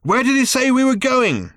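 high, loud shout. A narrator's reading. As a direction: yell it into his ear. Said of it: "Ford shouted in Arthur's ear".

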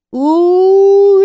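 very high, loud drawn-out howl; loud repeated cry. A narrator's reading. Tanny calling out from the cot, loud high wail. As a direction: wail it long at the top of the voice. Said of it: "Tanny said again".

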